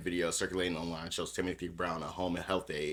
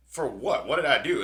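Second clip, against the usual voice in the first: mock indignant protest; 8 semitones higher, 10 dB louder than usual. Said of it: "And he's like".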